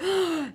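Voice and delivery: mock swooning gasp; high gasp of sudden delight; excited gasp. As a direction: gasp as if love-struck. Retelling her thrilled reaction.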